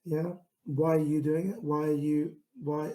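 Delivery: normal talking voice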